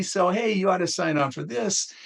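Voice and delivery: high-pitched, breezy salesman pitch; mock sales pitch. Insurers pitching plans to doctors.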